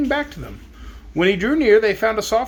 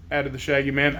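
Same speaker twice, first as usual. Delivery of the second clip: plain reading voice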